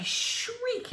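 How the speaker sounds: retold shriek of pain, held down in volume; mock shriek. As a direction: squeal it as a contained shriek.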